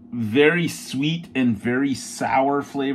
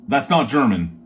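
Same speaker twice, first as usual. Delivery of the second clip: normal talking voice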